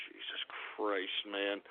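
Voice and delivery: barely audible, spent and fed up; a defeated under-the-breath mutter; weary whispered sigh. Acted. Exasperated host sighs to himself.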